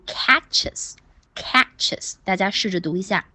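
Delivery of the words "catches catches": "catches" slowly in two clear syllables; correct ending. Shown as a demonstration. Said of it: "要读catches". (慢读 catches，两个音节都读清楚)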